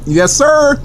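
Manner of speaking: high eager yes sir; excited yes sir. Pretend. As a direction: answer with pumped-up glee. Answers own question.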